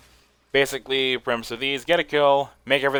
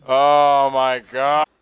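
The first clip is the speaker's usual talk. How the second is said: stunned; 3 semitones higher, 9 dB louder, much slower than usual. Real, not acted. Stunned reaction during gameplay.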